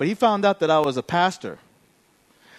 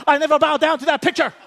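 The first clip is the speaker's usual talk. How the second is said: high-pitched mock-pious protest; self-righteous declaration; 5 semitones higher, faster than usual. Gym guy declaring his faith to pastor, self-righteous.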